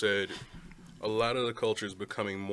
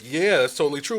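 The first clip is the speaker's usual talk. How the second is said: louder, brighter mock agreement; mock eager agreement; 5 semitones higher, 11 dB louder than usual. Speaker voices half of himself agreeing eagerly, louder. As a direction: gush it like you're fully sold. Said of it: "half of me is like".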